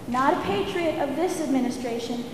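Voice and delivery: normal talking voice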